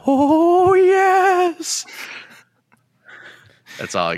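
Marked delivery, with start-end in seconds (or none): 0.1-1.9 very high, loud drawn-out wail; Paul Bearer. Pretend. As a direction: wail it out long and high in a put-on character voice. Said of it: "your best Paul Bearer impression".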